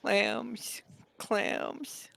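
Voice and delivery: high squeaky creature begging for clams; pleading pet voice. Acted. Baby creature begs its owner for clams, squeaky and high. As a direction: whine it high and small like a needy pet. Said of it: "He goes".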